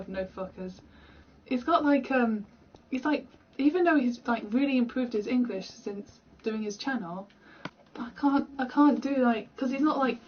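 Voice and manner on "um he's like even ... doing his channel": ordinary talk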